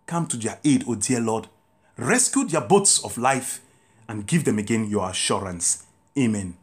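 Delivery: prayer. Acted. Leads the congregation in prayer.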